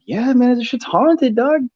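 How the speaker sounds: spooked tow guy. Retold or imitated. Tow guy spooked by the haunted house, high mock fear. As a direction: squeak it out like a scared tow guy. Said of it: "he's, like, freaking out".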